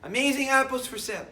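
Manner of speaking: high, loud market cry of apples for sale; loud vendor's call. Retold or imitated. Rabbi recalling the tzaddik's call to buyers, loud market cry. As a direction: belt it like selling in the square. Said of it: "like what Rabbi Chaim Mitzanz did".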